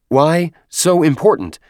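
high, broken-speech character voice; exasperated deacon. A narrator's reading. Exasperated deacon questions companion in story.

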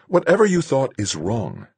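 high, firm woman's voice. Acted. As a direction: cut him off firmly. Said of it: "Marguerite said firmly".

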